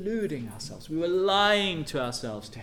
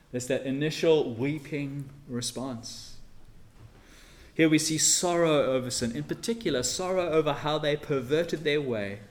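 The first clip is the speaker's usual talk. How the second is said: ordinary talk, nothing marked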